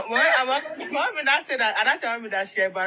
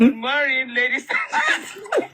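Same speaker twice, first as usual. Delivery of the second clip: mock formal mother speech; 3 semitones higher, 6 dB louder than usual. Mother addressing the PTA meeting, hammed-up and laughing. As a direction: ham up a mother's proud address. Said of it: "She now say".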